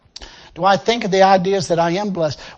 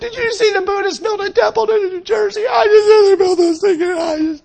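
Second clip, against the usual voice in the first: much higher, hammed-up whimpering wail; fake-sob it like a whiny believer; preacher parodying a believer crying over a temple; mock crying; 13 semitones higher, 6 dB louder than usual. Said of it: "weeping and crying".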